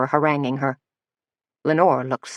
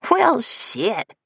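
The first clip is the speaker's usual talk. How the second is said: drawl; 7 semitones higher than usual. Acted. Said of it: "in a kind of drawl".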